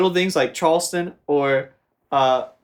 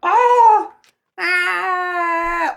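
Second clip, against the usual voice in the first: much higher and louder scream; 5 dB louder than usual. Acted. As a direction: let out a piercing scream. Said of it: "I was screaming".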